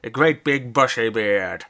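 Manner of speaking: slow, drawn-out put-on character voice; hammed-up film impression, very slow; Hot Fuzz character voice. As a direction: stretch it out slowly like a film quote. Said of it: "why I put that voice on".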